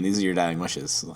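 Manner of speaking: ordinary talk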